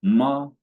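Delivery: "Ma" high, loud and level; "Ma" as a steady Mandarin first tone. Shown as a demonstration. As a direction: sustain "Ma" up high without falling. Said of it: "The first one is".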